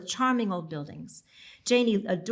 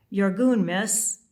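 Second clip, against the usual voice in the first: put-on Scottish accent; 6 dB louder, much slower than usual. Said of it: "he spoke with a Scottish accent".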